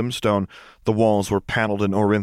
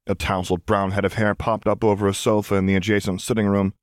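ordinary delivery, nothing marked

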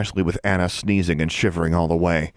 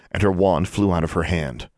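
plain reading voice

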